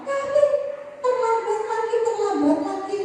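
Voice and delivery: angry scolding teacher. Shown as a demonstration. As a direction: berate the late pupil and send him out. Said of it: "gurunya di depan sudah menenteng seperti ini, marah-marah".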